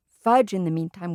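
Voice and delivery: ordinary delivery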